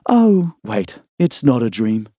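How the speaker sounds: a hint of a groggy young woman just waking; young woman's voice. A narrator's reading. Emma voiced, higher.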